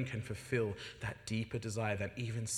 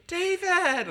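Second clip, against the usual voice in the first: high, loud operatic shout of his name; dramatic call; 12 dB louder than usual. Retold or imitated. Opera-singer mum calling son home, loud and dramatic. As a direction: call him like an opera diva. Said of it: "she's an opera singer, so it's always dramatic".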